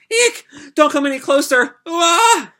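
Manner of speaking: very high, loud terrified shriek; a child recoiling from the thug; screaming in fear, very high; frightened shriek. Acted. Girl shrieks at approaching thug.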